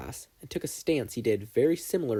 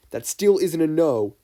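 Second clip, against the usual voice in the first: teasing; 6 semitones higher, 9 dB louder than usual. Acted. Todoroki teasing his friend.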